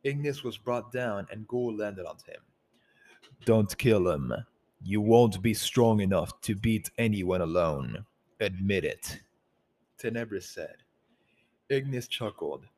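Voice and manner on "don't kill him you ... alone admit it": commanding alpha voice. Acted. Tenebris speaks commandingly.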